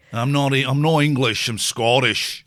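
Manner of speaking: playful put-on Scottish accent; mock Scottish accent. Mocking the actor's line with a light Scottish accent. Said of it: "more of a Scottish accent than what he was doing".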